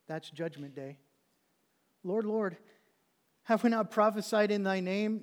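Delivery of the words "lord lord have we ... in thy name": raised preacher's reading voice; loud, controlled recitation; measured scripture reading. Acted. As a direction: read the verse out firmly, without panic. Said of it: "I don't think they're gonna be saying this".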